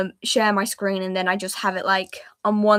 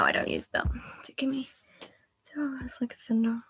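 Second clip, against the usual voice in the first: whisper; 3 semitones higher, 8 dB quieter than usual. Real, not acted. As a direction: whisper to yourself. Whispering while drawing.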